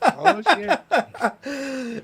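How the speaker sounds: high, laughing "oh, shit". Real, not acted. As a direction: crack up over the words. Laughing at the story.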